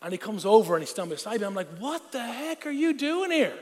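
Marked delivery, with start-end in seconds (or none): 1.8-3.6 higher, slow, astonished; stunned disbelief. Retold or imitated. Son seeing his dad walk into church, stunned. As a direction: stretch it out in shock.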